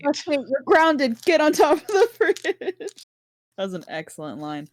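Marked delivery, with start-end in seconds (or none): 0.0-2.4 high mock-parent yell breaking into laughter; screamed scolding. Parent grounding pestering kids, mock scream with a laugh. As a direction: scold the kids in a raised, higher voice. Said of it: "Batani screamed".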